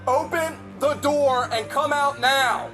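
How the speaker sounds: barking police commands. Real, not acted. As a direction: bark it at him. Police ordering suspect out.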